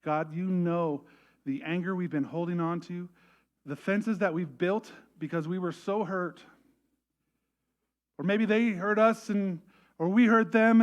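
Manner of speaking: earnest prayer. Real, not acted. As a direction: pray with rising fervour. Prays aloud.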